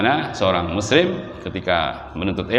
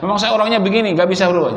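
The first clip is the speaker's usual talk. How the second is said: higher-pitched protest, acted out; mock stubborn excuse; 7 semitones higher, faster than usual. Preacher voicing a person who refuses to change, mock stubborn. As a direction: lightly imitate someone insisting they can't help it.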